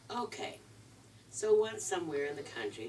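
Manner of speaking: usual speaking voice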